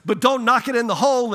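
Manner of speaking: quick alarmed caution; urgent warning. Retold or imitated. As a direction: call out the warning before it's too late. Friend warning him on river.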